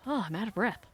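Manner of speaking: normal talking voice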